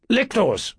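high-pitched summons, little added volume; commanding shout. A narrator's reading. Ruler summons his attendants, high commanding shout. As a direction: call sharply for the guards. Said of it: "Caesar shouted".

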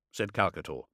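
ordinary delivery

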